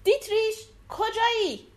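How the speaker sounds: high shout searching a dark cave; calling out. A narrator's reading. Hildebrand, after killing the giant, shouts for Dietrich. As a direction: call across the cave for a missing friend. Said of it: "فریاد زد".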